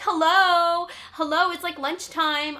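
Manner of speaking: loud, high shouted hellos at a locked door; calling out. Retold or imitated. Hungry girl shouting hello through a locked door, loud and high. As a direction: yell through the door to anyone inside.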